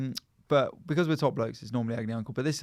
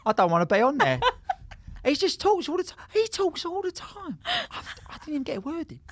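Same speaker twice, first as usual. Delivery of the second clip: high, louder mocking impression of a friend; Nicole impression; 13 semitones higher, 3 dB louder than usual. Mocking his co-host Nicole, high-pitched. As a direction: squeak it out as her. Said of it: "It's my Nicole impression".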